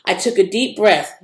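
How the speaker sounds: ordinary delivery, nothing marked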